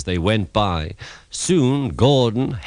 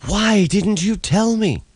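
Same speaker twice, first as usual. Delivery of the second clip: high loud accusing question; high upset protest; indignant; 12 semitones higher, 4 dB louder than usual. A narrator's reading. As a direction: demand it in outrage. Diesel outraged at Duck.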